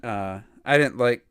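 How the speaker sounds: usual speaking voice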